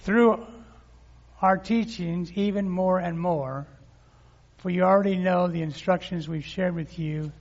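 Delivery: energetic pulpit reading; preaching. Real, not acted. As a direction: proclaim the verses to the congregation. Preaching scripture to congregation.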